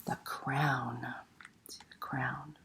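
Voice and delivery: hushed, awed whisper. Acted. Librarian whispering the crown's name to the kids, awed whisper. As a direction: breathe it like a precious secret. Dramatic whispered repeat in story.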